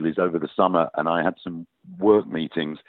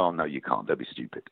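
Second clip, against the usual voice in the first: low, quiet brush-off, lightly voiced dad; dismissive dad; 8 semitones lower, 8 dB quieter than usual. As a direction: imitate a dad dismissing the idea under his breath. Dad dismissing his child's acting dream.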